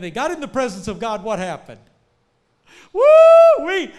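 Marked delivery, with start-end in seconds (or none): 2.9-3.9 loud high preacher's whoop; joyful whoop. Acted. Preacher whooping to the congregation about God's presence. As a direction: let out a joyous woo-wee.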